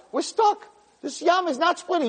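high-pitched acted alarm; urgent realization. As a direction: exclaim as if suddenly trapped. Acts Nachshon realizing they are trapped.

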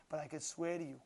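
low, soft sincerity; quietly testify, with understated emotion; retold tearful confession. Man swears to his rabbi, quiet heartfelt confession. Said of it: "he started crying".

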